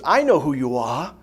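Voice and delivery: a hint of the old baboon; lightly voiced Rafiki. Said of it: "And he goes".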